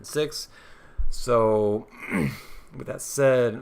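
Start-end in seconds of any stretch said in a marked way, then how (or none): 1.1-3.6 hoarse, strained voice losing out. Real, not acted. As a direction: push through a failing, hoarse voice. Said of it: "I'm losing my voice".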